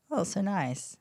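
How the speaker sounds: high, sing-song fake gratitude; pleased thanks to a partner handing her juice; mock delight. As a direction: gush over the gift lightly. Receiving suspicious gift juice.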